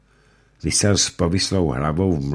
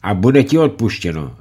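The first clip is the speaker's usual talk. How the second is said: high, loud pressing demand; coaxing judge; 8 semitones higher, 9 dB louder than usual. Acted. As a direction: press him for the answer. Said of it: "řekl rychtář".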